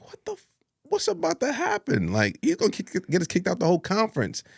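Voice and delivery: high, fast, mock panic; panicked. Fearing the organizer will throw them out, panicked. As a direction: freak out as the kick-out looms.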